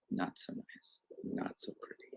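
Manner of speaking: whispered, deflated verdict; very soft, almost voiceless aside; hushed disapproval. Shown as a demonstration. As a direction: drop to a whisper for the letdown.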